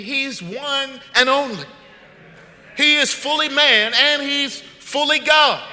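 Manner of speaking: slow, loud proclaiming shout; preaching at full force. Acted. Pastor proclaims Jesus to the congregation, slow and loud. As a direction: drive each word home to the church.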